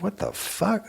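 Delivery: high, soft stunned disbelief; shocked whisper. Retold or imitated. Man seeing his fever reading, high soft shock. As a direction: gasp it out, floored.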